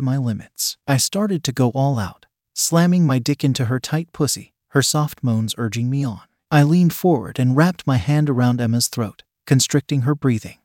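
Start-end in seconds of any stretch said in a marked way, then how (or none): none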